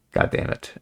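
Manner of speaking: whispered under the breath; a real frustrated whisper; muttered curse. Real, not acted. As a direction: curse quietly to yourself when the thought slips away. Frustrated over losing his train of thought.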